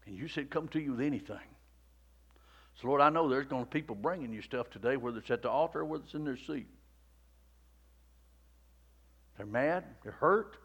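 very soft, low, hushed praying voice; quiet prayer. Acted. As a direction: pray gently over the congregation. Soft altar call and prayer.

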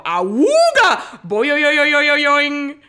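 loud, high awooga and springy boing; long, high cartoon horn into a bouncing boing; cartoon catcall sound effects. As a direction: howl it like a lovestruck cartoon wolf. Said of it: "I could do the sound effects".

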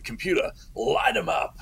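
high, theatrical call to the computer; son's hammed-up command. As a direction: announce it like a big show host. Son's cheesy command to home computer.

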